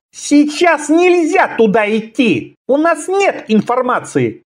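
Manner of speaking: very high, tense protest; insist, voice ringing out. A narrator's reading. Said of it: "Голос его зазвенел".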